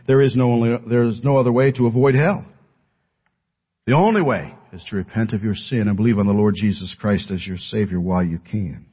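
normal talking voice

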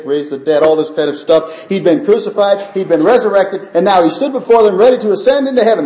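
high, loud fervent preaching; preaching at full force. Acted. Preacher telling the disciples scene, high and loud. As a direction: lift it up and drive toward heaven.

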